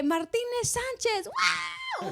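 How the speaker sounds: slow drawn-out name, then a high shouted wow; cheering crowd. Retold or imitated. El hispano llamado en la graduación. (grito de porra con wow muy agudo)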